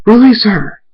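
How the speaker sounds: high, loud commanding shout; furious demand. Acted. Enraged Thunderstorm demands Dashy's release, furious high shout. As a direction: shout it as an angry order.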